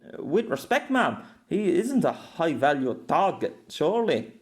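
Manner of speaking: deferential northern English objection; northern accent. A narrator's reading. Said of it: "the northern detective said back".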